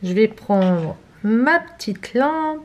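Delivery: ordinary talk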